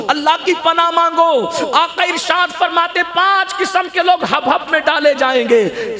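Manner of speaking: impassioned sermon delivery, driving to emphatic peaks; fiery preaching. Real, not acted. Preacher warns his congregation about hell's hottest valley, fiery preaching. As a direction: preach it with full conviction to a crowd.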